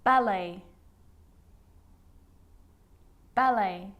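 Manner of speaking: "Ballet" as BAL-ay, no final T; careful model of "Ballet", ending on ay; silent T. Shown as a demonstration. Teacher showing the sneaky T, careful repeated model. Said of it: "it can also be silent".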